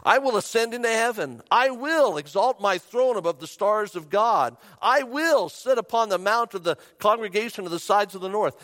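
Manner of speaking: loud, high, defiant declaration; ringing boast, pitched high; self-willed boast. Retold or imitated. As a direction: proclaim it with swelling self-will. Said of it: "You said in your heart".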